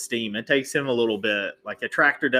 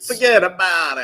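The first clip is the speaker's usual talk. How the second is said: loud, higher mock New York accent; 5 semitones higher, 7 dB louder than usual. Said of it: "my, uh, New York accent".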